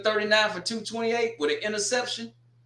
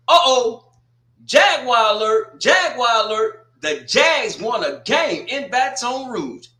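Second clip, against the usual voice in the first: high, loud, slow alarm-bell announcement; a hammed-up warning call, drawn out; mock alarm call; 6 semitones higher, 7 dB louder, slower than usual. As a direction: sound the alert like a mock siren going off. Mock siren alert for a Southern win.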